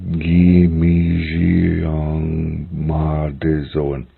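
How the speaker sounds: "Gi Mi Ji Yang Ma De Zoen" very slowly, each syllable stretched; slow model pronunciation. Shown as a demonstration. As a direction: draw out every syllable, zh in "Ji". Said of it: "this is a sh sound".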